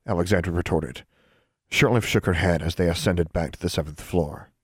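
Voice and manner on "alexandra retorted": ordinary delivery, nothing marked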